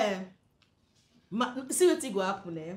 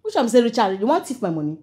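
loud suspicious; 12 dB louder than usual. Acted. Madam suspicious, confronting.

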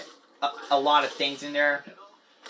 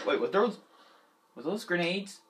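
normal talking voice

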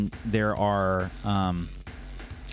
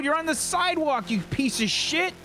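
high, confrontational yell; stomping up to the van to yell; furious pedestrian; faster than usual. Acted. The pedestrian walks up to the van shouting, high and furious.

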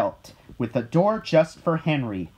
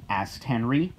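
plain reading voice

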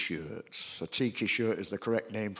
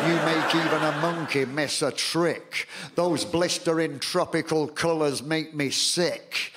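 raised, rhythmic poem delivery a bit higher and louder; poem recital; 5 semitones higher, 3 dB louder than usual. Acted. Poet performing to a live audience, declaiming rhythmically. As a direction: declaim it to the crowd with punch.

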